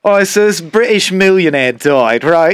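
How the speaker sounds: hammed-up Cockney storyteller, pitched high; Cockney accent. Podcaster retelling a dirty-limerick joke, in a put-on Cockney accent. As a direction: tell it in broad, drawn-out Cockney. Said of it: "does it in a Cockney accent".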